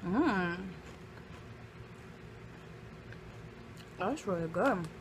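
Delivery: talking while chewing chip; muffled closed-lip hum; mouth full, chewing. Real, not acted. Seen 0: Bites chip at -4, then closed-mouth chewing through the words.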